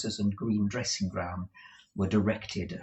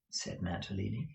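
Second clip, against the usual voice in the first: ordinary delivery, nothing marked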